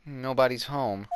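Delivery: ordinary talk, nothing marked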